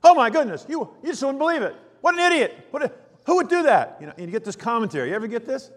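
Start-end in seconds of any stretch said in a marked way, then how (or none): 0.0-4.0 much higher, louder exasperated gossip; hammed-up outrage, squeaky and fast; indignant phone rant. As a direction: complain to a friend on the phone about an idiot driver. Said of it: "on the phone talking to somebody who's driving".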